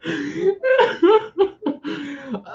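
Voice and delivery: real laughter, squealing high; a laugh that runs away with her; high breathless laughing burst; high helpless laugh. Real, not acted. Laughing fit.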